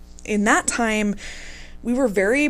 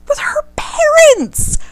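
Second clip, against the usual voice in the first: high, slowed, laughing outrage; laughing disbelief; 7 semitones higher, much slower than usual. Real, not acted. Telling listeners about the double date, slow laughing outrage. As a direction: stretch it out in disbelief.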